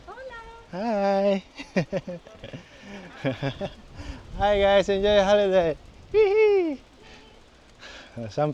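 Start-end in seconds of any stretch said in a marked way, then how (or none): 0.1-5.8 cheerful greeting. Real, not acted. 6.1-6.8 playful high-pitched whoop. Real, not acted. Whooping at passersby.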